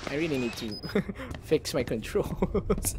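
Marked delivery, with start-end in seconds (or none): 1.1-3.0 quiet words breaking into a giggle; soft, amused self-mockery; laughing. Real, not acted. Laughs at own fumbling.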